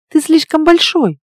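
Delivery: high-pitched uneasy protest; tense. A narrator's reading. Woman objecting to her huge half-shifted lover, tense. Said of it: "напряжённо сообщила я".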